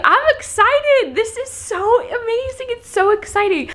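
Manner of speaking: high, gushing excitement; excited. Real, not acted. Vlogger gushing about trying the new phone, excited.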